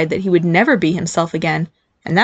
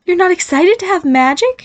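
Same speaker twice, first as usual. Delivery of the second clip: high-pitched, affronted question; taking it personally; 11 semitones higher than usual. A narrator's reading. Mother hurt by son's lack of interest in magic, affronted. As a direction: ask it as if personally slighted. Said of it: "seeming to take the idea personally".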